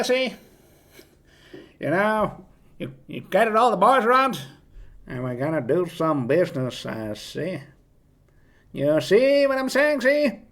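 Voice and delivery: put-on high, punchy old-movie gangster voice; hammed-up gangster rallying the boys; crime gangster. Shown as a demonstration. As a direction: talk like a crime boss, "see?" on every phrase. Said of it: "that accent goes a little bit like this".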